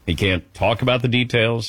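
ordinary talk

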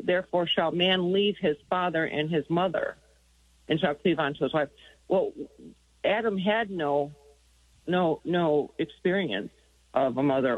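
usual speaking voice

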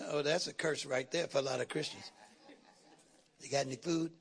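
low, soft teasing aside; muttered joke to the congregation; joking aside. Retold or imitated. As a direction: drop your voice for the joke. Joking aside about hungry Christians.

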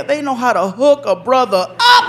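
ordinary delivery

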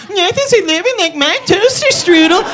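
high, squeaky little dough-man voice; Doughboy voice. Acted. Comic telling the crowd how the Doughboy talks, in a high squeaky voice. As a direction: squeak it out like a tiny excited doughboy.

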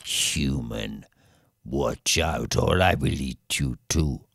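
low, soft, menacing dragon; a deep quiet menacing dragon voice; dragon threat. Acted. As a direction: growl the warning low and quiet.

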